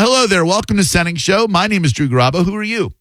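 high-pitched, sing-out show welcome; announcer-style hello to a caller; radio host greeting. Acted.

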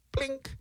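tiny high soft blink; imitated light going on. Host mimicking a light going off in his head, tiny squeak. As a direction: squeak it small, like a little bulb. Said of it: "and it went".

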